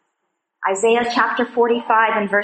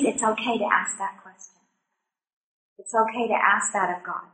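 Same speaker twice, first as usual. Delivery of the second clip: much softer, gently reassuring; soft reassurance; 2 semitones lower, 7 dB quieter than usual. Real, not acted. Telling the women it is okay to ask God why, softly. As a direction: ease their guilt quietly.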